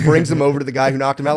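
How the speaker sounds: ordinary talk, nothing marked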